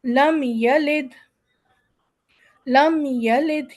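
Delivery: لم یلد slow, meem of لم short, no nasal hold; lam-yalid, the meem crisp; clear meem. Shown as a demonstration. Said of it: "یہاں پر ہم کوئی وزن نہیں دیں گے".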